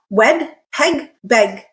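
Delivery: "Wed, peg, beg" with a clipped short flat eh; wrong short vowel. Shown as a demonstration. Teacher demonstrates the odd-sounding short eh. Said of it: "it sounds really odd".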